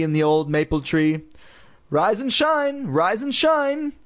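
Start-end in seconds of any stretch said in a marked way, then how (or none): 1.9-3.9 high sing-song wake-up call; mother calling up. A narrator's reading. Mother shouting up the stairs to wake the girls. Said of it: "Missus Powell shouted up the same".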